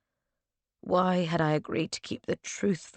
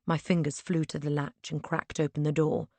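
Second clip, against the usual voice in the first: ordinary delivery, nothing marked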